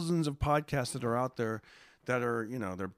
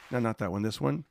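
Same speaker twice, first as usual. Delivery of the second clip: ordinary delivery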